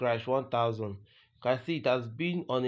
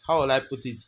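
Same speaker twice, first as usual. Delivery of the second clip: normal talking voice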